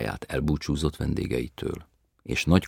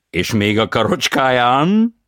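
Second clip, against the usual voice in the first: foreign tenor voice; 10 semitones higher, 13 dB louder, slower than usual. A narrator's reading. Tenor answers.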